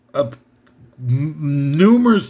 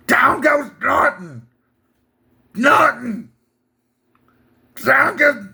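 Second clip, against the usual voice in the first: high, slow announcer call; hammed-up sports announcer; Howard Cosell impression; 6 semitones higher, slower than usual. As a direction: call the knockdown like ringside. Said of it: "Howard Cosell's famous ringside announcing".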